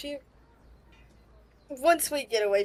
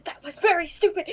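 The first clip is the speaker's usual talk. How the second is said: gentle scolding; 8 semitones higher, 4 dB louder than usual. Acted. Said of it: "lightly, gently".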